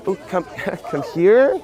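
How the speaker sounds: high, hesitant mock invitation, lifting at the end; wrong, unsure tonality. Shown as a demonstration. Pickup coach showing how not to call her, hesitant. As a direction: imitate asking her over tentatively. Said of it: "it's not like".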